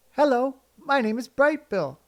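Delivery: high, bright little gosling voice; a small proud gosling announcing his name. A narrator's reading. Gosling greeting anyone who will listen, high and proud.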